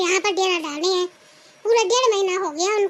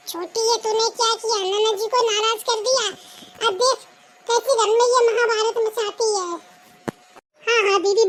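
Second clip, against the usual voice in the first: usual speaking voice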